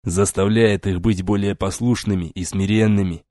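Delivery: usual speaking voice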